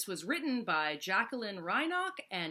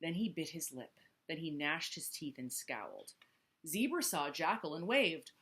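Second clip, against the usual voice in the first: plain reading voice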